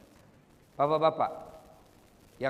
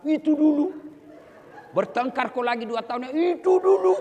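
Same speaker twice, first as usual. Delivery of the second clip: high-pitched, louder mock wife nagging, building up; 12 semitones higher, 8 dB louder than usual. Preacher mimics a nagging wife dredging up husband's past mistakes, shrill and rising. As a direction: harp on it like a wife who won't let old mistakes go.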